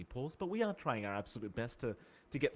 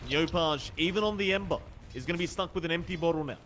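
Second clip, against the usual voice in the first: louder, higher rising commentary; excited play-by-play; 4 semitones higher, 5 dB louder than usual. Acted. To viewers and John, calling a near-kill with rising excitement. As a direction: build the hype as the gank nearly lands.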